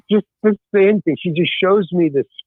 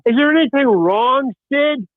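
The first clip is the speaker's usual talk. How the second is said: retold yell; 7 semitones higher than usual. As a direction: imitate a crewman calling out to a colleague. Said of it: "the cinematographer yells".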